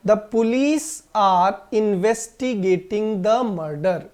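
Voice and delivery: very slow, word by word; slow model sentence. Shown as a demonstration. Teacher reads the example sentence to the class very slowly. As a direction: dictate it slowly. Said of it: "sentence है".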